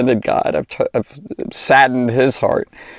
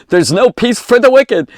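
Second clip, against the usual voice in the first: emphatic proclamation; 10 semitones higher, 5 dB louder than usual. Acted. Preacher exclaims scripture phrase.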